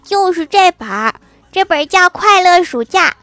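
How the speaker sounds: put-on high, loud child voice; put-on little kid, high and emphatic; 郭晓霞. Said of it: "郭晓霞举起她的暑假作业说".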